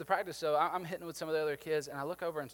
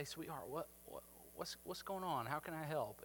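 gentle comfort; 10 dB quieter than usual. Retold or imitated. Kneeling beside crying little girl.

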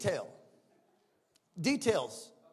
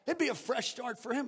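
usual speaking voice

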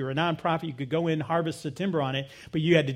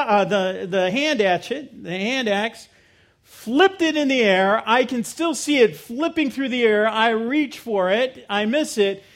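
ordinary talk, nothing marked

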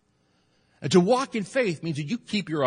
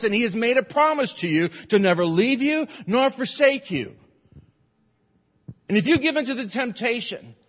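full-force preaching; 9 semitones higher, 5 dB louder than usual. Acted. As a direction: preach it home, building to a shout. Preaching climax on God's promise.

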